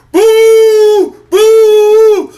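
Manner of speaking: long, loud, high-pitched boo; imitated crowd boo. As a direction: boo him like a hostile stadium crowd. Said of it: "They booed him coming out".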